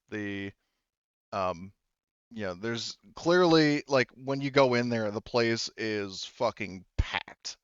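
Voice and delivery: usual speaking voice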